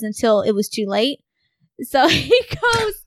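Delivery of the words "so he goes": laughing. Real, not acted. Laughing while telling story.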